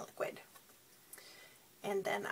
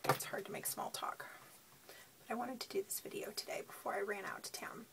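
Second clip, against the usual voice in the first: tired; 9 dB quieter, faster than usual. Real, not acted. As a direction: talk like you barely slept. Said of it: "I'm just tired".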